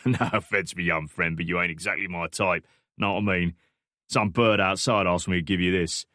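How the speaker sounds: lightly voiced Cockney stranger; offhand Cockney handover; Cockney accent. A narrator's reading. Stranger handing Alex a phone, offhand Cockney accent. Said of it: "thick Cockney accent".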